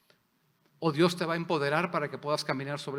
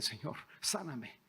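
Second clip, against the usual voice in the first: quiet plea; 8 dB quieter, slower than usual. Retold or imitated. Sick person begging Jesus.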